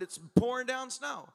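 ordinary delivery

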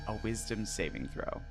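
normal talking voice

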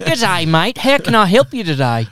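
a bit of a put-on Australian greeting; mock Australian accent. Siri imagined answering in Australian, lightly hammed. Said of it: "this one's Australian".

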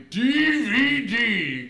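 loud, drawn-out letters; mock grand shout. Showing off a film collection to friends, mock grand shout. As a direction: stretch each letter out at full volume.

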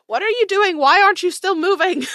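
high, loud and alarmed, demanding an answer; shocked shout. Acted. Ristos shouting at the party on the stairs, high and loud. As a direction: yell up the stairs in disbelief. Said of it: "And she's like".

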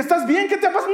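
high, loud acted teacher's shout; alarmed calling. Teacher asking the boy through the door, alarmed calling. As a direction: call anxiously through a closed door.

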